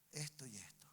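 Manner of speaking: low, hushed whisper; whispered revelation. Retold or imitated. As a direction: whisper it like an inner prompting. Voicing the Holy Spirit's inner showing.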